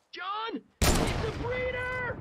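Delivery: very high, loud, drawn-out scream; screaming warning. A narrator's reading. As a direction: yell the warning across the room. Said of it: "I screamed".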